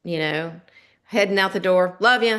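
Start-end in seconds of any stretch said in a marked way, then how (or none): 2.0-2.4 louder, offhand goodbye; snippy love ya. Retold or imitated. Wife heading out the door to husband, offhand and snippy. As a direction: toss it over your shoulder, a little curt. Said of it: "it's just the tone of it".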